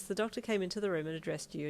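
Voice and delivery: ordinary delivery, nothing marked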